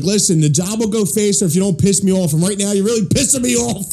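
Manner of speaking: high, rising exasperation; imitated angry boss. Boss telling the client's wife off by phone, imitated yelling. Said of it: "I've heard him yell at the wife on the phone before".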